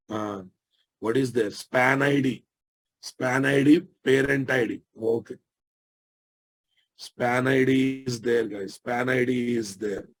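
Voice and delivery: usual speaking voice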